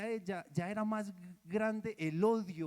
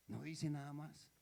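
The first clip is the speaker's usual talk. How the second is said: hushed echo; 5 semitones lower, 11 dB quieter than usual. Shown as a demonstration.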